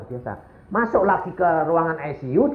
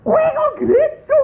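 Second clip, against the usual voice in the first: incredulous shriek. Retold or imitated. Husband amazed to hear his wife went to church. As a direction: squeal it in astonishment.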